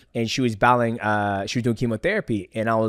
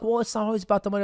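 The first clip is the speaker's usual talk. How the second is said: mocking people; 10 semitones higher, much faster than usual. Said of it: "And people are like".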